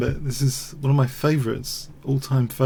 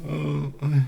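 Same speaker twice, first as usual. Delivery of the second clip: normal talking voice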